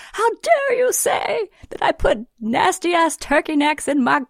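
mock the friend's wounded pride, up high; outraged friend. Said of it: "He's like".

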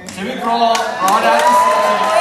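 shouted. Shown as a demonstration. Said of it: "repeated louder".

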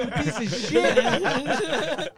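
retelling cursing out the driver, slow angry yell through laughs; retold angry yell. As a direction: spit it slowly at the driver, half laughing. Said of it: "And I'm like".

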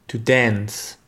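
to dance in American English; "To dance" with a flat a, "dæns". Shown as a demonstration. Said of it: "[name] le dira à la façon américaine". (en anglais américain, "dance" avec un a plat)

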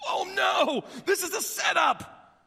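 high, loud alarmed shout; panicked cry. Acted. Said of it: "and they're like".

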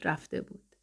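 last echo whispered and far away; fading to a whisper. Acted. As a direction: breathe the words out as the song dies. («رفته بود» نجواگونه و محو، پایان آواز)

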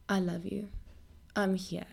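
soft and low, with space between phrases; tender reassurance. Acted. Preacher voicing what a struggling person needs to hear, tender reassurance. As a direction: quietly comfort someone who is hurting. Comforting words for someone struggling.

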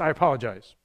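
short, dismissive apology; curt apology. Retold or imitated. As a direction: toss off a bare apology without meaning it. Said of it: "Wouldn't that be better than just".

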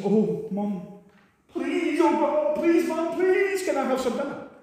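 put-on child begging Mum for dinner, high and whiny; pleading child. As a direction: beg Mum like a hungry kid.

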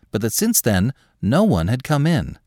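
ordinary delivery, nothing marked

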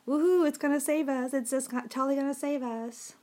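high, loud mock celebration of the tech; sarcastic cheer. Real, not acted. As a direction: fake a big woohoo to mock the phone. Mocking cheer at failing tech.

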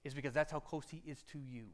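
usual speaking voice

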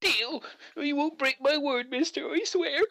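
put-on high, timid child promising; a chastened halfling boy; meek kid. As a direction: swear it eagerly like a scared kid.

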